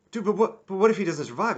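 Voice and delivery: high, loud mock whine; mock whiny protest. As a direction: squeal it like a panicky sidekick. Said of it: "He's not like".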